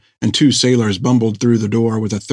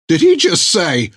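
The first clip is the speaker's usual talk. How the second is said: alarmed; 6 semitones higher than usual. Acted. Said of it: "he became alarmed".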